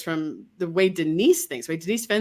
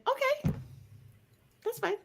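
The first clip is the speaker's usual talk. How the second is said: soft accepting; 9 dB quieter than usual. Shown as a demonstration. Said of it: "you'll go like".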